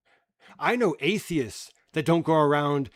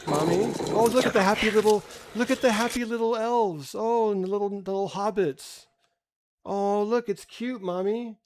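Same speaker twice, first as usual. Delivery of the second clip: put-on whiny child; 4 semitones higher than usual. Mocking a kid begging Mommy to stop the movie. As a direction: beg Mommy like a frightened little kid.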